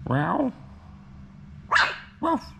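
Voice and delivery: ordinary talk, nothing marked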